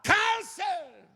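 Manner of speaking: high shout, the last words stretched very slowly; healer's commanding cry; shouted rebuke. Retold or imitated. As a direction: cast the cancer out. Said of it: "só escutei ele gritar".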